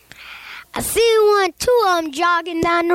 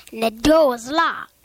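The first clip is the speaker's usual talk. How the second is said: usual speaking voice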